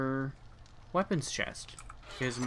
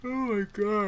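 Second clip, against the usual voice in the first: high yawn pushing out the words; a tired yawn in the middle of a stream; 9 semitones higher, 3 dB louder than usual. Real, not acted. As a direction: let the words ride a yawn. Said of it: "I don't know why I keep yawning".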